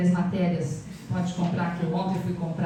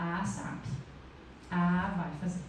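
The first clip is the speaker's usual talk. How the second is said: low, grudging mutter; half-hearted grumble as if pestered; reluctant mutter; 3 semitones lower, 5 dB quieter, slower than usual. Retold or imitated. Imita a própria voz relutante. (resmungo relutante e mais grave)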